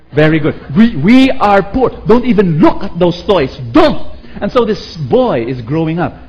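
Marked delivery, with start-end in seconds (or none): 0.1-4.2 louder, firm scolding; scolding mother. Retold or imitated. Mother scolding son not to look at toys, loud. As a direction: forbid the toys sternly.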